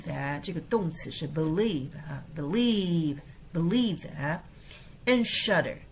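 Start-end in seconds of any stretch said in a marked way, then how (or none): 4.0-5.8 "and shudder" slow, weight on "shudder"; stressed shudder. Shown as a demonstration. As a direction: draw out "shudder" slowly. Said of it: "所以shudder跟believe".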